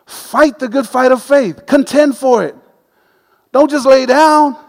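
slow, deliberate preaching push; preaching exhortation. Acted. Preacher urging the congregation, slow and emphatic. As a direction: urge them to keep fighting.

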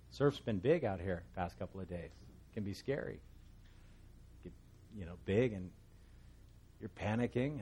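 normal talking voice

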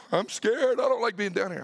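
high, loud frightened little voice; scared child. Retold or imitated. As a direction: mimic a toddler afraid of the dark. Son in dark basement.

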